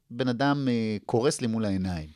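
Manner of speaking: ordinary delivery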